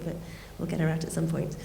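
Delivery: ordinary delivery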